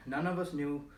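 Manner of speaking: usual speaking voice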